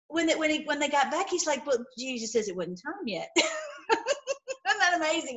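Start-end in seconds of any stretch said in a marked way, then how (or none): 1.7-5.4 soft chuckling retelling; laughing. Real, not acted. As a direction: laugh through the excuse. Retelling soldiers' excuse laughing.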